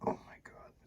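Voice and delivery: breathless whisper of delight. Real, not acted. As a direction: whisper it in awe. Savoring the meal.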